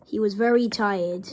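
ordinary delivery

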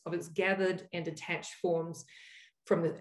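ordinary talk, nothing marked

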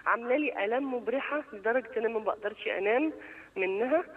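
ordinary delivery

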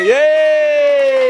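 cheering. Real, not acted. Cheer after blowing candles.

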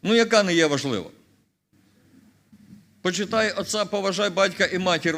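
normal talking voice

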